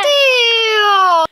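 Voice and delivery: high, loud, long-held wow; stretched excited shout; amazed cry. Pretend. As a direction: wail it out in pretend amazement. Reacts to pretend treasure.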